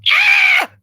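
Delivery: sudden high shout of anger; angry yell. Retold or imitated. Imitating Chris when he's angry, a yell. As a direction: let out a sharp angry yell. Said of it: "you're like".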